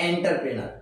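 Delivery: "এন্টারপ্রেনার" said as "en-tar-pre-nar"; common mispronunciation. Shown as a demonstration. Teacher showing what we usually say, "এন্টারপ্রেনার" as en-tar-pre-nar.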